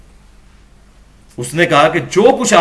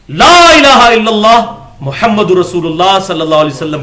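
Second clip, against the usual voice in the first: solemn recitation; 4 dB louder than usual. Real, not acted. Reciting the kalima from hadith.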